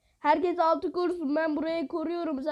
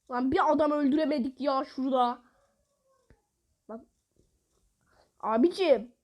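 ordinary talk, nothing marked

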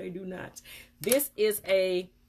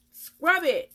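emphatic; 9 semitones higher, 8 dB louder than usual. Real, not acted. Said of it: "I mean".

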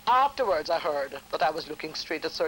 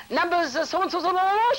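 mimicking a clerk calling numbers, higher; rattled-off call; 12 semitones higher, 5 dB louder than usual. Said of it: "she rattle off some number".

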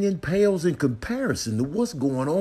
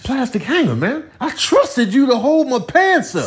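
mock betrayed; 8 semitones higher than usual. Addresses hanger as betrayed friend.

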